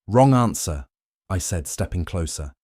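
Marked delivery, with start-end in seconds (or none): 0.1-0.9 firm retort. A narrator's reading. Husband turns the tables.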